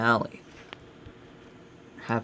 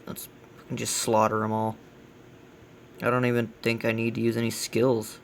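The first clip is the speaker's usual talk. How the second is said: usual speaking voice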